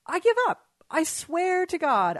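real fed-up yelp; exasperated outburst. Real, not acted. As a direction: burst out high and loud in frustration. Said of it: "I'm so frustrated right now".

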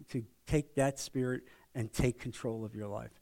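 tender, sincere invitation; loving, gentle. Shown as a demonstration. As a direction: ask gently, as if to a friend you care for. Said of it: "when you talk like that in a loving way".

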